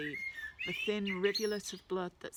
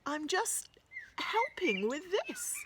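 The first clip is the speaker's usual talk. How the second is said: put-on muffled, slow, higher boy's voice; 12 semitones higher, 3 dB louder, slower than usual. A narrator's reading. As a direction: speak muffled behind the tissue. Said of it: "he says in a muffled voice".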